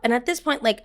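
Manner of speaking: ordinary talk, nothing marked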